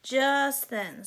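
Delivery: ordinary delivery, nothing marked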